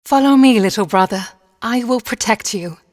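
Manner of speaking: high, loud call to follow; calling out boldly. A narrator's reading. Lillemor leads her little brother on, calling out boldly. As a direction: ring out as the fearless sister leading on.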